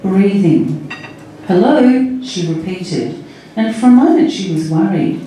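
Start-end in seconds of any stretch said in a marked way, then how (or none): 1.5-2.2 higher-pitched, rising hello; questioning phone greeting. A narrator's reading. Sally to a silent phone line, questioning.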